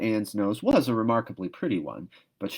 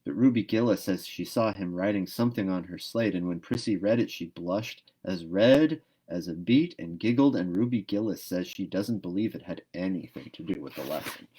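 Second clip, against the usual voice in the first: ordinary delivery, nothing marked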